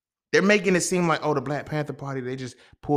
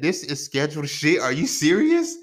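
incredulous; 5 semitones higher, 6 dB louder than usual. Retold or imitated. Retold disbelief at the cop.

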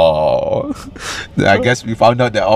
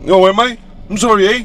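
higher, mock sorry Canadian; apologetic robber; 9 semitones higher than usual. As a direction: act surprised and sorry, eh. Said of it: "robber answers the cop".

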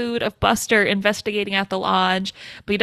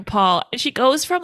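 ordinary talk

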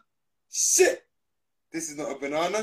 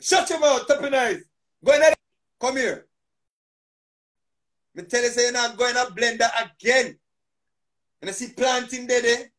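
scolding the banana; 6 semitones higher, 10 dB louder than usual. Pretend.

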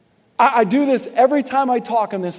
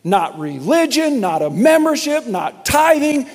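loud, slow, emphatic sermon voice; full-force preaching; 5 semitones higher, 7 dB louder, slower than usual. Acted. Pastor drives home the only way to heaven, loud and emphatic. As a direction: hammer each point home.

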